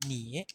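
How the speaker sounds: "Ni" en tercer tono del mandarín; tercer tono chino. Shown as a demonstration. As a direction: pronuncia "Ni" con el tercer tono. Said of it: "se escribe ni con tercer tono". ("Ni" en tercer tono del chino)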